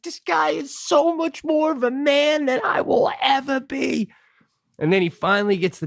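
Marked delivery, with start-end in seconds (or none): 0.0-4.1 awed high voice. Retold or imitated. Awed aside about the mechanic.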